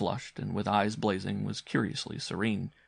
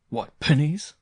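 high, loud teasing question; mock the boy lightly, amused; mildly laughing uncle; 7 semitones higher, 8 dB louder, slower than usual. A narrator's reading. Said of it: "laughed the uncle".

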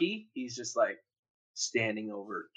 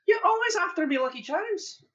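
high, loud Irish cereal-mascot accent, more Scottish; 3 dB louder than usual. Shown as a demonstration. Asked for the tagline, a high, hammed-up Irish accent. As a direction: pitch the Irish accent way up, hamming it. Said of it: "in an Irish accent".

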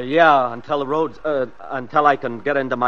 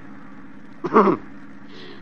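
soft menacing laugh; breathy sinister chuckle; 6 semitones higher, 6 dB quieter than usual. Acted. Killer laughs at his captives, sinister chuckle.

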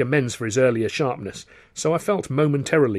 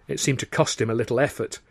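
ordinary delivery

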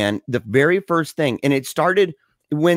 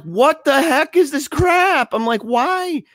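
higher, slow, exasperated outburst; retold exasperation; 13 semitones higher, slower than usual. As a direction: let the annoyance climb up high. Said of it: "So I'm really mad".

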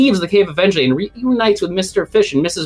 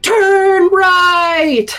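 put-on kids yelling; 4 dB louder than usual. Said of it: "all the kids loudly screaming".

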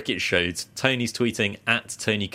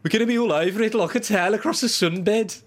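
high-pitched, exaggerated Australian accent; Aussie impression; 11 semitones higher than usual. Shown as a demonstration. Redoing the line like his co-host did last week, Aussie impression. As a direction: put on a broad, squawky mock Aussie accent. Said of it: "to do the Aussie impression like Tone did last week".

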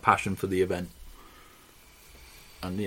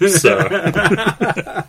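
laughing; 9 semitones higher, 12 dB louder than usual. Retold or imitated. Reading mum's letter, laughing.

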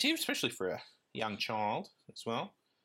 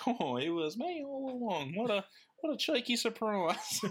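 Grover voice; 9 semitones higher than usual. Retold or imitated. Host voicing Grover revealing himself, high and giggly. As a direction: own up sweetly like a cheeky puppet. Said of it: "he's like".

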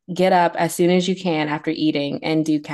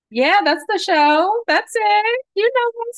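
excited; 14 semitones higher, slower than usual. Real, not acted. Excited on the phone.

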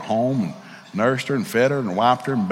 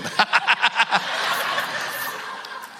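soft airy laugh; 9 semitones higher, 5 dB quieter than usual. Real, not acted. Laughs at his own joke.

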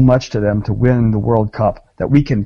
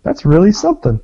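much higher, fake-chipper congratulations; mock oblivious; 9 semitones higher than usual. Joking with friends about France, mock chipper and oblivious. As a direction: gush like you never heard of soccer. Playing oblivious American fan.

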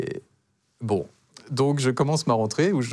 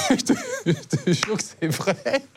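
laughing, drawn-out protest in a higher pitch; 11 semitones higher, slower than usual. Real, not acted. Man swearing to a sceptical friend his voice is fake, laughing. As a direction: swear it through helpless laughter. Rit en jurant que c'est vrai.

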